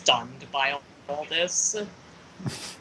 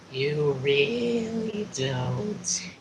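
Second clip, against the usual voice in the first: slow, drawn-out alien voice; slow eerie voice; 5 dB quieter, much slower than usual. Acted. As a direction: drag it out, otherworldly. Voiced Starmie line, slow.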